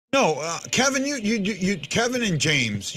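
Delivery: ordinary delivery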